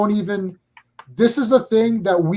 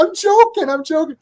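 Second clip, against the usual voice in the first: very high protest, easing on the repeat; pleading protest; 10 semitones higher than usual. Retold or imitated. Retelling pleas to the unhappy coworker, high defensive backpedalling. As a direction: backpedal in a high voice, then drop off.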